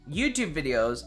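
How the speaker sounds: ordinary delivery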